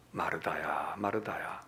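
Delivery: Jesus calling softly, low; gentle low call of a name. Acted. Jesus gently calling Martha, soft and low. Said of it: "주님의 음성이 어땠을까".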